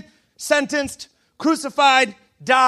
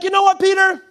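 higher-pitched, pointed telling-off; mock confrontation; 7 semitones higher, 4 dB louder than usual. Preacher as Jesus to Peter, playfully confrontational. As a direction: lead in as if about to scold.

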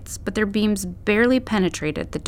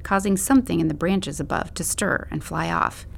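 ordinary delivery

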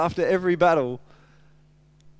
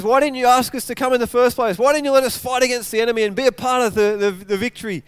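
raised, louder voice of indignant protest; angry complaint; 5 semitones higher, 6 dB louder than usual. Retold or imitated. Preacher voices Ephraim confronting Gideon, angry complaint. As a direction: confront him with an aggrieved demand. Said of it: "they're angry".

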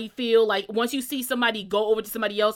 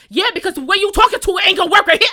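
loud, fast and high; friend cutting in; 8 semitones higher, 10 dB louder, faster than usual. Retold or imitated. Friend Sarah cuts in on the catcaller, loud and quick. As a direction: jump in hard on his side. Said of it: "She's like".

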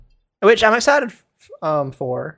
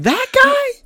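high squeak of disbelief, much higher; shocked disbelief. Retold or imitated. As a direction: gasp out "That guy?" in shock. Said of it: "You're like".

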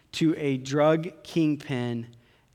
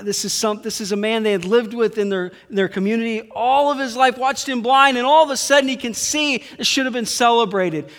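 higher and louder, pressing the point home; impassioned preaching; 8 semitones higher, 7 dB louder than usual. Acted. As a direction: preach it at full force to the congregation. Preaching builds to full force.

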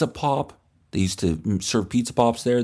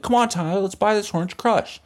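put-on pushy cool kid; 10 semitones higher than usual.

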